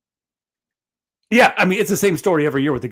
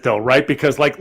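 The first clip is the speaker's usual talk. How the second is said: ordinary delivery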